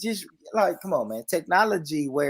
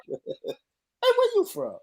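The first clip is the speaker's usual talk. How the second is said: whispered high mock British accent; half-whispered silly British question; breathy high British mock; much slower than usual. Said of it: "I can just hear it".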